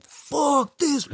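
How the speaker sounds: high-pitched, strained mock yell; defiant outburst. Seeker defying amused guides, high defiant outburst. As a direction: act out fed-up revolt. Acted defiance against his ego.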